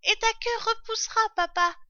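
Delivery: put-on very high, loud child's voice; little fox cub. A narrator's reading. Fox cub asks his father about his tail, high childlike voice. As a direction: pipe up like a small cub. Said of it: "dit l'un des renardeaux".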